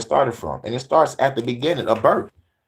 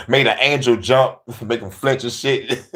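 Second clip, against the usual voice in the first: usual speaking voice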